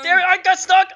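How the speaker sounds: high, loud cry of frustration; frustrated shout. Real, not acted. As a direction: yell out as you get stuck. Panicking as time runs out.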